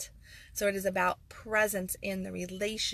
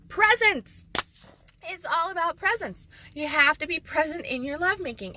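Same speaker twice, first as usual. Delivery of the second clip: much higher and louder, a shout; triumphant reveal; 10 semitones higher, 8 dB louder than usual. Real, not acted. Host revealing the big answer after a pause, shouted. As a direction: burst out with the answer. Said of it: "the dramatic pause".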